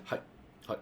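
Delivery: short, separate "hi"s at an ordinary pitch; respectful listening. Shown as a demonstration. Listener showing respect in Japanese, short attentive hi. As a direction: give a brief, attentive hi, pause, another. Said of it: "I'm listening, I'm showing respect".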